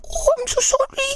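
very high squeaky bacteria voice; high-pitched pleading little creature; apologetic bacteria. Acted. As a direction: squeal a tiny germ's panicked apology. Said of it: "they're like".

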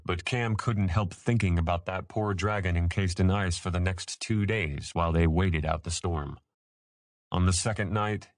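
usual speaking voice

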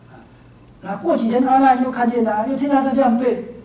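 normal talking voice

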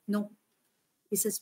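normal talking voice

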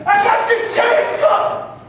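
high, drawn-out cry; desperate outcry. Acted. Homeless man calling out to God about his change, desperate outcry. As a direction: cry it out to heaven, voice pitched high.

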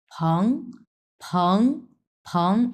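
"ফং" repeated separately with a rising second tone. Shown as a demonstration. Teacher breaks the word up for learners, second tone. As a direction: say "ফং" alone, lifting it in second tone. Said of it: "প্রথমে আছে দুই number tone".

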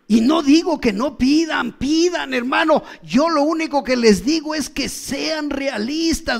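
fervent pulpit exhortation; preaching. Acted. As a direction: urge the congregation hard. Preacher at full force.

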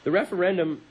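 measured storyteller's read, phrase by phrase; dramatic reading. Acted. Host reads a campus protest story on air, dramatic narration. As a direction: read the history out like a story to the listeners.

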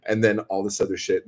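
ordinary delivery

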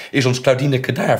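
put-on high, very loud child's plea; urgent question. Child asks the driver about Claudineke, urgent and loud. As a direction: ask urgently, voice jumping up. Child anxiously asking after sister.

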